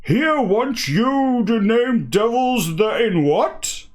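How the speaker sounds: put-on slow, roaring Guernsey accent, pitched high; hammed-up Guernsey roar; Guernseyman roar. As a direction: bellow it like a Guernsey sailor. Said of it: "oh, I've got a roar".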